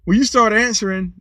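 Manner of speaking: ordinary delivery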